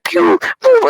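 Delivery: very high, quick pew-pew laser sounds; blaster noises. Retold or imitated. Kid retelling Order 66, squeaking high blaster shots. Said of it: "he's like".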